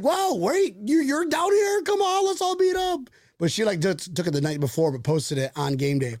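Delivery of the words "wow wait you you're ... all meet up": high-pitched mock-amazed exclamation; excited surprise. Fan finding a friend is down here, excited surprise. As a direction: act out delighted disbelief, way up high.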